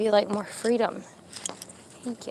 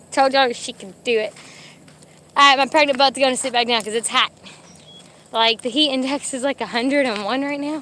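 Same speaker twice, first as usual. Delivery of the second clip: ordinary talk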